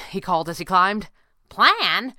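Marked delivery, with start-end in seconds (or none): 1.5-2.1 high, loud, rising echo question; disbelieving cry. A narrator's reading. Squirrel asked for a plan, disbelieving cry. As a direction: throw the word back in disbelief. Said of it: "she cried in disbelief".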